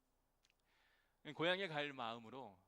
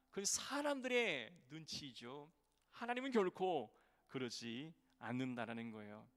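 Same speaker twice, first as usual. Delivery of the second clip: usual speaking voice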